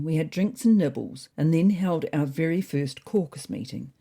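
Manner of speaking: ordinary delivery, nothing marked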